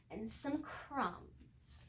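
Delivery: ordinary delivery, nothing marked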